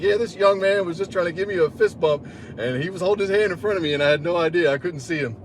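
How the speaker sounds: put-on high old man explaining. Blind old man explaining to the trainer, high voice. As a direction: pitch up as an amused old gent.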